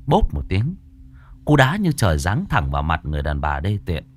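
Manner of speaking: ordinary delivery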